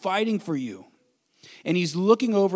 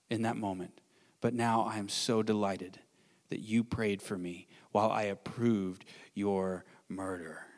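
tender gratitude; 7 semitones lower, 4 dB quieter than usual. Acted. Voicing Paul in heaven, crying.

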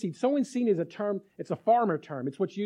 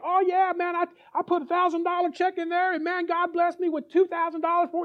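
boasting preacher; 10 semitones higher, 3 dB louder than usual. Retold or imitated. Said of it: "They're like".

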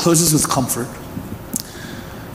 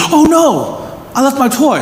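put-on child's voice; 7 semitones higher, slower than usual. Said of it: "And he goes".

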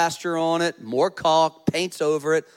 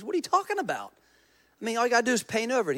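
high-pitched, softer retold protest; incredulous; 6 semitones higher, 3 dB quieter, much faster than usual. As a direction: act out a baffled customer, pitched well up. Acted incredulous customer arguing with painter.

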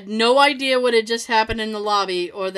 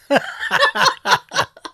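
laughing; 6 dB louder than usual. Real, not acted. Laugh after story line.

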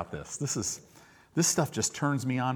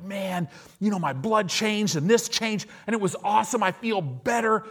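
higher, eager testimony voice; excited bragging; 7 semitones higher than usual. Retold or imitated. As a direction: gush like you just got healed. Voicing excited churchgoer.

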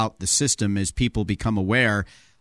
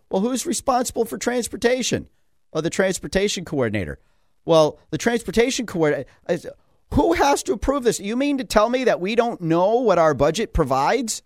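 incredulous mock interrogation; 8 semitones higher than usual. Retelling grilling of officials.

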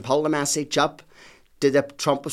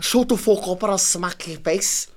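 put-on Scouse accent; 4 semitones higher, 3 dB louder than usual. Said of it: "Is that your, your Scouse accent?".

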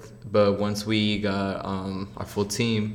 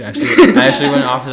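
real laugh bursting through quick, loud words; high, loud, laughing admission; 6 semitones higher, 15 dB louder, much faster than usual. Real, not acted. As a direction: blurt it out fast while laughing. Amused at own advice.

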